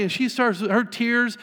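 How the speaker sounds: ordinary delivery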